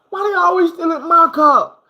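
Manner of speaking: high, loud startled mama; just woke up, car gone again; groggy mama outraged. Retold or imitated. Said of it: "She's like".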